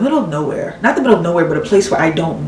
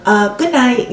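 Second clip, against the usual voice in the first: higher-pitched, hesitant broken English; imitating halting English speaker; 6 semitones higher than usual. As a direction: mimic her searching for English words. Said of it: "She can barely speak any English".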